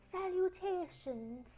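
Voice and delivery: high, soft and slow greeting; a tiny spider calling down sweetly; thin spider voice. Acted.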